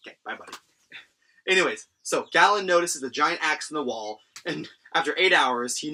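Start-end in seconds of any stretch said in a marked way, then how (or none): 0.1-0.6 breathy whisper to the pet; whispered goodbye. Real, not acted. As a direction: whisper it softly to the animal. Quiet farewell to pet.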